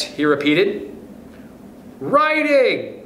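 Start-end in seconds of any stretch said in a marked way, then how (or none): 2.0-3.0 high-pitched class call; pupils' cry. A narrator's reading. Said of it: "cried the scholars".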